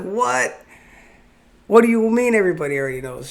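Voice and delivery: hammed-up retold astonishment; incredulous shock. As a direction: blurt it out, stunned all over again. Retold shock, everybody knew.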